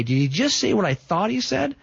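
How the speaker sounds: pitched high, mock disbelief; incredulous. Listener stunned by Jesus's claim, high incredulous question. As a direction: sound as if you can't believe your ears.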